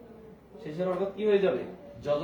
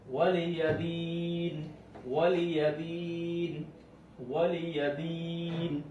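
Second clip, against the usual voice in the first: ওয়ালিয়াদিন chanted slowly with a long ি before ন; slow recitation; much slower than usual. Shown as a demonstration. Teacher demonstrating madd to students, slow. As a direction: stretch the long vowel, stop at the end. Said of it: "মধ্যে আরজিটা".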